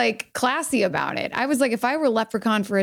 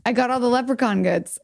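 ordinary talk

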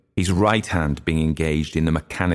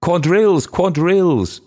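high, loud excited call; excited young woman calling the dancers; excited cry; 13 semitones higher, 6 dB louder than usual. A narrator's reading. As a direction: cry it out in a high voice to the whole room. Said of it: "excitedly clapping her hands and crying".

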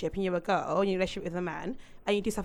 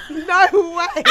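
laughing; 10 dB louder than usual. Real, not acted. Refusing to cut the blooper.

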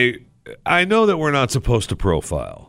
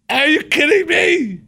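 high, loud outraged disbelief; 5 dB louder than usual. Retold or imitated. To TSA agent in line, a high loud outraged blurt. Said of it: "I'm like".

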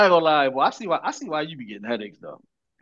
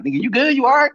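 higher, louder mock check-in; mock puzzled concern; 9 semitones higher, 6 dB louder than usual. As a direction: imitate a baffled friend checking up. Friends reacting to his polite greeting.

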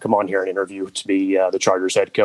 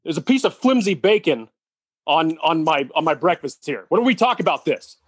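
mock breakfast complaint; 7 semitones higher than usual. Mock complaint about breakfast bacon.

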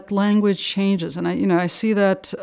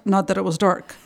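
ordinary delivery

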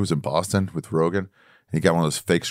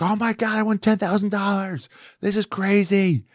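much higher, hammed-up squeal of joy; overjoyed winner; 11 semitones higher than usual. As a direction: play the giddy lottery winner. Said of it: "He's like".

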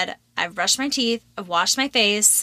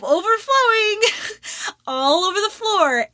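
laughing squeal; 11 semitones higher than usual. Real, not acted. Laughing while telling toilet flood.

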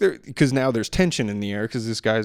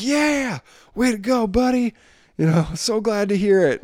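high, louder cheering; hooting and hollering; 10 semitones higher, 3 dB louder than usual. Retold or imitated. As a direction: whoop for him as he drives off. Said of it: "hooting and hollering".

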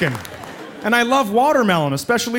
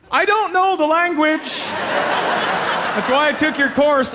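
higher, slow, pleading whine; exasperated plea; 7 semitones higher, much slower than usual. Retold or imitated. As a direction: beg the teacher, drawn out and laughing. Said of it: "En français".

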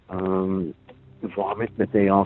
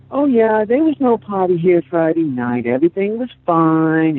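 high, loud mock-breezy retelling; mocking imitation; 8 semitones higher, 5 dB louder than usual. Grandparent mimicking the woman on TV denying the party, mocking. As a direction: imitate her breezy denial, pitched up and loud. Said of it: "she's like".

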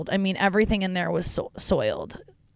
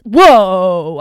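startled whoa; 13 dB louder than usual. Real, not acted. Sudden lightning seen while driving.

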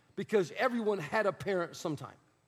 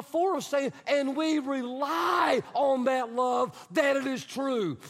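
fervent preaching; 3 semitones higher than usual. Acted. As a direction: proclaim it like a preacher. Preaching scripture at full force.

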